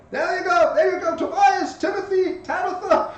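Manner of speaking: very high, loud squawking call; penguin parent. Acted. Penguins lobbing their kids at the train, high squawky calls. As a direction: call out each child's name in a shrieky pitch.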